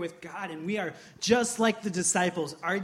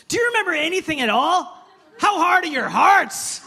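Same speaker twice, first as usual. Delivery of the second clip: much higher, loud exasperated shout; Jesus shouting; 10 semitones higher, 8 dB louder than usual. Retold or imitated. Preacher as Jesus yelling at disciples, exasperated shout. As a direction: yell it at the forgetful disciples. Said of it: "He's like".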